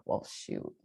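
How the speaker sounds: breathy hushed "well, shoot"; soft whispered aside; stunned whisper. Retold or imitated. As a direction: let it slip out under your breath, caught off guard. Mother stunned by son's answer.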